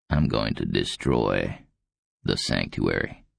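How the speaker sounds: put-on very low, slow old man's vow; deep, deliberate, menacing old growl; dry croaky voice. A narrator's reading. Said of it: "dry, croaky voice".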